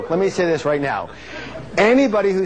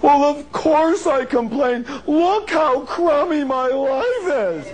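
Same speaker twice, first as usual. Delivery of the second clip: high, drawn-out whiny wail; exaggerated moaning, pitched way up; mock complainer; 11 semitones higher, slower than usual. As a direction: imitate a chronic complainer, hammed up. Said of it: "complainers usually have a crummy life".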